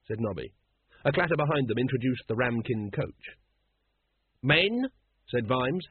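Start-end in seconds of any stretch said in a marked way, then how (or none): 4.4-4.9 high, questioning call; hesitant. A narrator's reading. Vimes checking with his men through the fog, hesitant. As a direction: ask uncertainly, pitch rising. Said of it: "hesitantly, peering through the fog".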